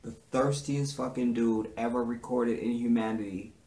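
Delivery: normal talking voice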